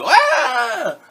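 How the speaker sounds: high, loud shriek; shrieking storybook monkey; monkey scream. A narrator's reading. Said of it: "And the monkey screamed".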